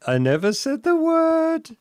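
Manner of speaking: squeaky indignant denial; high-pitched protest. Real, not acted. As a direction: shoot the voice up in protest. Protesting accusation.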